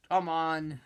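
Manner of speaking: ordinary talk, nothing marked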